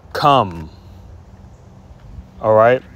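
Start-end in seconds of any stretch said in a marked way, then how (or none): 0.1-0.7 short drawn 'come' closing on a hummed m; masculine plural ending. Shown as a demonstration. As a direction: say 'come' slowly, lips closed at the end. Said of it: "the masculine is".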